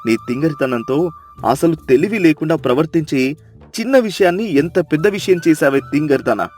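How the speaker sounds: low, fast scolding husband. Acted. Chandranna scolding silly Sita before the crowd, low scolding. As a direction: tell her off for her foolishness. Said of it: "నాలుగు చివాట్లు పెడతాడు".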